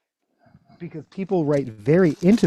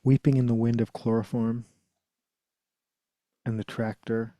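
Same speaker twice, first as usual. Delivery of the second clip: poem reading; 3 semitones lower, 7 dB quieter than usual. Acted. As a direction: let each image hang in the quiet. Reads Ted Hughes poem.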